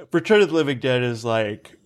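talking through a yawn; words strained by a yawn; choking. Real, not acted. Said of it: "I'm choking to death".

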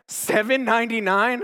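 stunned price-tag read; surprised disbelief. Retold or imitated. Reading the cheap price tag.

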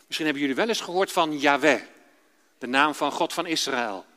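usual speaking voice

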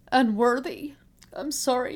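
real tears rising in her voice, pitch jumping up; choked-up apology; voice breaking. Real, not acted. Mother apologizing to her son, voice breaking. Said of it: "even talking about it now is hard".

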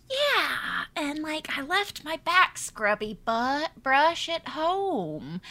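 put-on high, slow, sweetly coy girl; lightly hammed-up coy heroine. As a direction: play the innocent with a high, slow voice. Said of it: "she's like".

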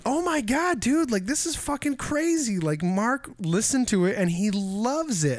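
high, thrilled retelling of her outburst; excited. Retold or imitated. Retelling his co-host's reaction, high excited. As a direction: gush like you can't believe it. Co-host retold freaking out.

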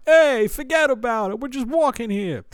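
high mock New York tough-guy voice; hammed-up New York wiseguy; New York accent. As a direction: toss it off like the hat guy. Echoing the New York hat guy.

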